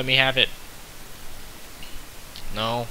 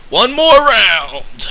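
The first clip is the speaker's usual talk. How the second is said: hammed-up announcement; 8 semitones higher, 6 dB louder, much slower than usual. Announcing another round.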